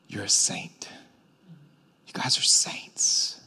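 soft, breathy whispered reminder; hushed whisper. Acted. Paul reminds the church softly, a whispered loving reminder. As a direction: lean in and whisper it tenderly.

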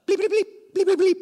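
high-pitched rapid bleep noises; imitated censor bleeps. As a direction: beep out the swearing. Censoring officer's swearing with bleeps.

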